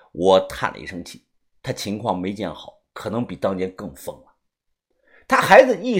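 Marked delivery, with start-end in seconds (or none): none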